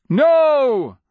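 high, loud scream of refusal; Nonman King's cry. A narrator's reading. Nonman King as the dragon charges the entrance, crying out. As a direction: cry out at full force. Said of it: "the Nonman King cried".

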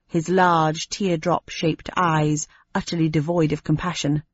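ordinary delivery

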